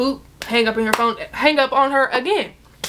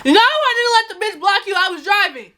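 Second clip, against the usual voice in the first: exasperated shout; 8 semitones higher than usual. Retold or imitated. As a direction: shout it back like you've had enough. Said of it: "That's literally what I said".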